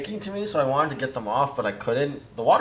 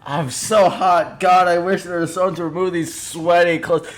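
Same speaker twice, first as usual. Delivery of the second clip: loud in-character yelling; 5 semitones higher, 4 dB louder than usual. Acted. [name] answers a whisper from under the table, yelling very loudly. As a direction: yell it out, drawing the words out. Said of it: "yelling very loudly".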